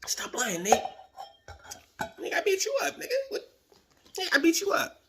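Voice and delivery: high squeaky imitation of the friend insisting he won; mock Corey. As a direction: squeak out his denial like a cocky rival. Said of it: "He like".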